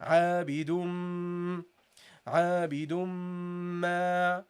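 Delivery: عابد ما slow, meme held with nasal hum; nasal ghunna. Shown as a demonstration. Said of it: "from the nasal area".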